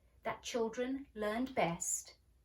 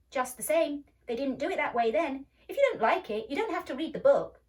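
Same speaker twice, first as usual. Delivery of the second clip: loud, higher, annoyed retort; annoyed boy; 6 semitones higher, 10 dB louder than usual. A narrator's reading. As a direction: shut her down sharply.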